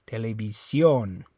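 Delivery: TELEVISIÓN as te-le-vi-SYON; TELEVISIÓN stressed on the accented O; stress on last syllable. Shown as a demonstration. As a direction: punch the final SIÓN of TELEVISIÓN. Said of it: "the stress is on that O".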